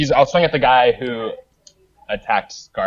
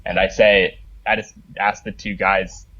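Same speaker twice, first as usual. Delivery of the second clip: ordinary talk, nothing marked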